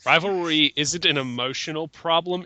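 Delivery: usual speaking voice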